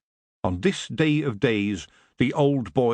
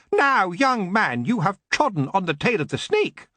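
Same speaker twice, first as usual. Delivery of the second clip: screeching elf lord; 8 semitones higher than usual. Acted. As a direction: drag it out in a high, mocking screech. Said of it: "Lord Lankin screeched".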